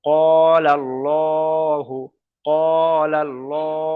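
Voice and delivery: ক্বালাল্লাহু very slow, long held vowels, full "llo"; recitation demonstration. Shown as a demonstration. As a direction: stretch ক্বালাল্লাহু on a level tone with a heavy L. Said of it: "উচ্চারণ করে দেখিয়ে দিচ্ছি".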